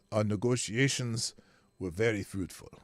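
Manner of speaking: put-on light Eastern European accent on the quote; a loose Eastern European accent, not quite Zelensky. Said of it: "an Eastern European accent".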